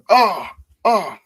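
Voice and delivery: two high, loud cries of alarm; acted high yelps of panic; pained cries. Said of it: "starts like".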